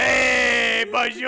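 loud, bad French greeting; horrible French accent. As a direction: call it out in hammed-up French. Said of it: "That's a horrible French accent".

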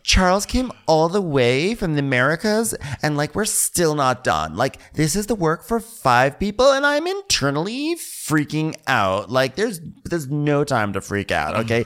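a hint of a frazzled designer; stressed designer voice. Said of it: "And she's like".

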